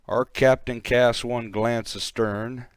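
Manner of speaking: ordinary delivery, nothing marked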